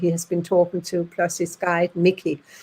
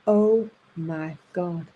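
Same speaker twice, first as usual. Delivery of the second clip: 1 stunned; 4 semitones lower, 4 dB quieter, much slower than usual. Retold or imitated. Hearing about the gas chamber.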